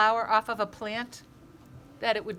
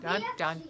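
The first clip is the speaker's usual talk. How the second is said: mock suspense fanfare; 11 semitones higher than usual. Mock suspense sound effect.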